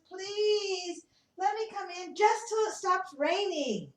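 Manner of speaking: high, light pleading voice; a little bird asking sweetly; begging bird. Acted. Bird asks the animals for shelter, high pleading voice. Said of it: "the bird comes over and says".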